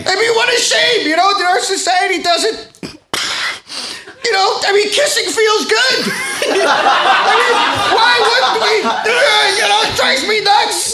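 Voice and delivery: very high, overwrought pleading; hammed-up tearful indignation; worked-up weepy rant. Guest laments society to the host, high and overwrought.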